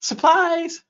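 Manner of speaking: high, bright punchline delivery. Acted. Punchline of the joke.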